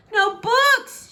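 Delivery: high wailing cry of no books; bewailing. Acted. Princess bewailing to the brambles, loud high wail. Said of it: "she bewailed".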